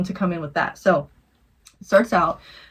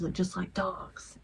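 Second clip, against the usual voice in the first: slow, eerie whisper; hushed, drawn-out reading of a sinister note; creepy whispered note; 12 dB quieter, slower than usual. Retold or imitated. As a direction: whisper it like a scary-story punchline. Creepy note repeated as punchline.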